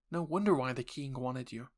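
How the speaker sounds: ordinary talk, nothing marked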